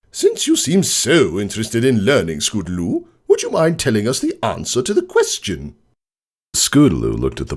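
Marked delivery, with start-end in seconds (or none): 0.1-5.8 high, mock-sweet teacher calling her out; sarcastic teacher. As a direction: put her on the spot with false politeness. Teacher sarcastically calls on pupil.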